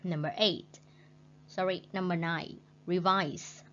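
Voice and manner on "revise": reVISE with the second syllable stressed. Shown as a demonstration. Teacher modelling revise with stress on VISE. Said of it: "stretch mark on second syllable".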